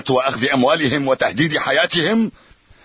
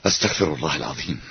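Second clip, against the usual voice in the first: quiet; 8 semitones lower, 6 dB quieter, faster than usual. Acted. Troubled chief sighs to himself.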